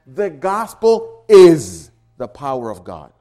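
"is" punched loud and held; stress on IS. Shown as a demonstration. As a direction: hammer the word "is", then drop away. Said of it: "Do you get the emphasis?".

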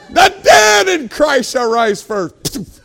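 loud, slow, high proclamation; preaching. Real, not acted. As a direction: proclaim scripture at full force. Preaches scripture at full force.

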